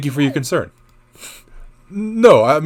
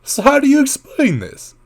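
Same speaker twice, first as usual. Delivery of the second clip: voice cracking; 11 semitones higher than usual. Acted. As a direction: voice cracks up high. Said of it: "My voice crackled".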